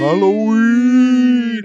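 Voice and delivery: very loud, high, long-held vowel; spooky Halloween howl. Pretend. Echoing the co-host's Halloween cry, hammed-up howl. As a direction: wail it slowly like a ghost.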